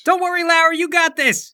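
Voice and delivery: high, loud cheer from the stands; shouted encouragement. Acted. Friend cheering Larry on from the crowd, shouting. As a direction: holler support to a friend.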